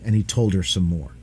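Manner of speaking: ordinary delivery, nothing marked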